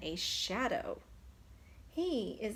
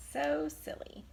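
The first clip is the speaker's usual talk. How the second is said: whispered giggle; 4 semitones higher than usual. Real, not acted. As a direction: whisper it fondly. Teacher whispers after laugh.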